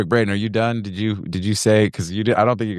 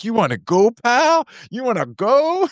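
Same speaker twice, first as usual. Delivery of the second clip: put-on Canadian accent; 14 semitones higher than usual. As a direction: taunt him in a hammed-up Canadian accent. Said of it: "so they got this Canadian accent".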